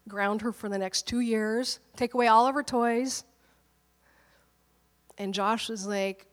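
ordinary delivery

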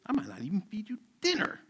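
high-pitched, hammed-up threat; mock threat. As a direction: pretend to be an outraged dad laying down punishment. Joking threat, retracted right after.

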